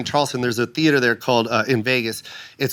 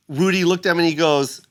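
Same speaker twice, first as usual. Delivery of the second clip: usual speaking voice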